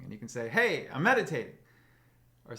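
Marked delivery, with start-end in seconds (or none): 0.5-1.5 loud, high mock retort to a partner; hammed-up announcement, much louder and higher; playful calling out. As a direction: call it out jokingly across the room. Acted answer to interrupting partner.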